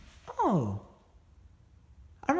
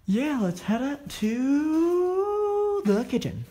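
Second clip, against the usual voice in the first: high drawn-out voice; 12 semitones higher, 4 dB louder, much slower than usual. Pretend. Ham exploring announcement.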